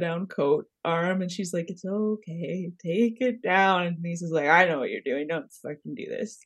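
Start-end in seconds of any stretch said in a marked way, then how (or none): 1.7-3.8 slow, soft mock soothing. Voicing Dorit stroking the coat. 4.5-6.4 low, soft imitation of an angry warning; hushed warning. Voicing Denise's angry warning under breath.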